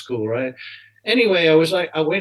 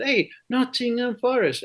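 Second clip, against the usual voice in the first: put-on higher-pitched halting English in a Middle Eastern accent; foreign accent; 10 semitones higher than usual. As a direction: imitate a Jordanian fan with little English, accented. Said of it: "he could hardly speak English".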